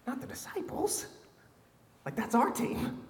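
a mock-shocked whispered aside. Real, not acted. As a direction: lean in and whisper it like a secret. Aside about Jesus' anger.

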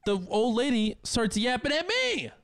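very high-pitched, incredulous complaint; squeaky-high outrage at being bothered; exasperated. Real, not acted. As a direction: let the voice shoot way up in exasperation. Exasperated telling of being yapped at.

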